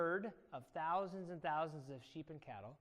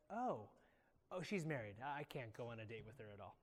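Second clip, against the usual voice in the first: soft, deflated backing off; subdued resignation; 7 semitones lower, 10 dB quieter than usual. Acted. Teacher imagines David learning she is married, quietly resigned. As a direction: let it sink lower and quieter to the end.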